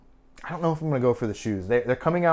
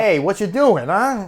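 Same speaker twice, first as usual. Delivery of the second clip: put-on loud, high tough-guy Brooklyn accent; brash New York street voice, Brooklyn accent; Joey's Brooklyn accent; 9 semitones higher, 9 dB louder than usual. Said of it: "he has a thick, like, Brooklyn accent".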